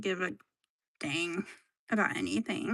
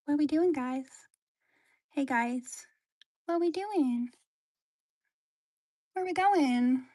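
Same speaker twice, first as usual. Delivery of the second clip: squeaky little pet voice, higher; 4 semitones higher than usual. Pretend. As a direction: speak for the pet, pitched up. Voicing pet's thoughts.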